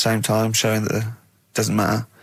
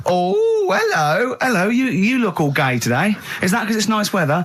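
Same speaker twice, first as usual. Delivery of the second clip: higher camp comic voice; sketch character; 9 semitones higher than usual. Acted. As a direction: play a chirpy caller in a sketch. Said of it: "It always starts with a sort of doorbell".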